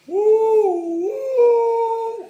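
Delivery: long, high, loud "woo" like a train; train whistle. Shown as a demonstration. Preacher imitating a train for the crowd, loud hooting. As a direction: hoot like a steam train whistle. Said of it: "trying to make a train sound".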